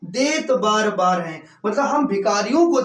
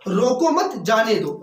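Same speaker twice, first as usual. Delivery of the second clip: "रोको मत" joined, then "जाने दो"; group मत with रोको, slow and deliberate; pause after मत. Shown as a demonstration. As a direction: stress मत and break after it. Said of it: "रोक, रोकिए नहीं".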